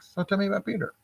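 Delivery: mock-casual, quiet and lifted in pitch; calm, casual. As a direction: ask it lightly and offhand, as if it were nothing. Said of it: "he's just being calm, casual. He's not yelling, screaming".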